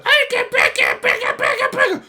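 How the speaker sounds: very high, loud squeaky shriek; squeaky gibberish. Pretend. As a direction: squeal nonsense at her.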